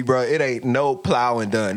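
normal talking voice

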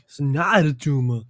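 echoed Austrian Arnold quote through a laugh; laughing copy in an Austrian Arnold accent; Arnold impression. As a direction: loose Austrian Arnold echo. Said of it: "the Arnold thing".

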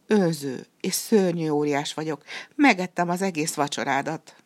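ordinary delivery

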